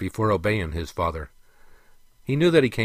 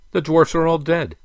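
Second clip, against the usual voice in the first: high, loud, heated counter; 9 semitones higher, 8 dB louder than usual. A narrator's reading. Prince counters the king's point about dwarfs, heated. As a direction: fire back insistently. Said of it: "countered the prince".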